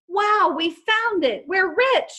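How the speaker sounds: high, loud squeal of delight; thrilled. Acted. Izzy squealing to the gang about treasure, high and thrilled. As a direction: shriek it like you just struck gold.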